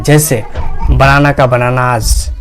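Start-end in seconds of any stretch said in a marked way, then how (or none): 1.5-2.3 बनानाज ending in a voiced Z/J buzz; final Z sound. Shown as a demonstration. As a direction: say बनानाज with the plural S turned to Z. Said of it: "जेड कहते हैं".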